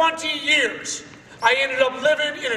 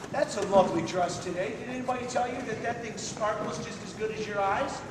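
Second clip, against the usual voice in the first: soft compliment; 3 semitones lower, 8 dB quieter than usual. Shown as a demonstration. Compliments a girl's dress.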